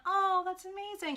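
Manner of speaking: very high, put-on gush; sing-song mock amazement; fake gushing. Shown as a demonstration. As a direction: imitate an insincere, over-polite compliment. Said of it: "not kind of like, in a".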